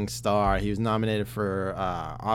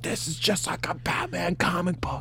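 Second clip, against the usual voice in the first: whisper; 7 semitones higher, 4 dB quieter than usual. Retold or imitated. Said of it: "I was like".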